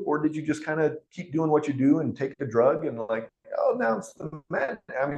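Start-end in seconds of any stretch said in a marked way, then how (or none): 3.6-4.8 mocking voice. Mocking someone relying on drug.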